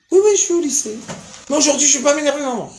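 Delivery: high boyish put-on voice; lightly voiced kid answering mom; mock schoolboy. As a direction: play the eager teenage son. Son voiced in story.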